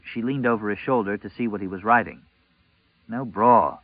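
ordinary delivery, nothing marked

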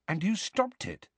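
high, softened wondering question; amazed, impressed. A narrator's reading. Harry hears Hagrid fought the herd, amazed. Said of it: "amazed and impressed".

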